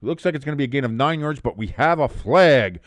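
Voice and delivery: ordinary talk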